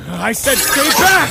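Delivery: slow, emphatic warning shout. Acted. Prince warning the guard to stay back, shouting. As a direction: bark the order to stay away.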